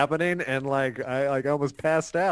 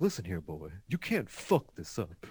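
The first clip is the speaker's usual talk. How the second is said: low, quiet confiding dad; Burt Reynolds; 8 semitones lower, 9 dB quieter than usual. Acted. Dad leaning in to his son, low confidential impression. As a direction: lean in close, keep it hushed and deep. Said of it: "he did a Burt Reynolds thing".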